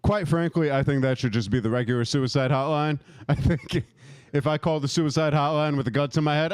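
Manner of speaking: ordinary talk, nothing marked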